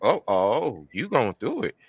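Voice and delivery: soft, swooping mock realisation; sceptical dragon. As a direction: size someone up in a hushed voice. Dragon sizing her up.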